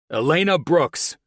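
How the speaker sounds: firm, loud and slow. A narrator's reading. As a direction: call her name firmly. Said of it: "Colin's firm voice".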